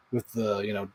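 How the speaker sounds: ordinary talk, nothing marked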